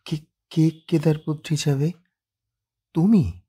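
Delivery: jolted by the news; startled, rising to a sharp question. Acted. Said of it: "চমকে ওঠেন ত্রিনয়নী".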